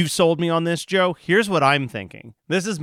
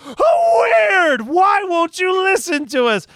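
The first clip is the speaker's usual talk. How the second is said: high, loud whining yell; mock exasperated shout; 6 dB louder, slower than usual. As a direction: scream it like an overplayed bit. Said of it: "I'm just like".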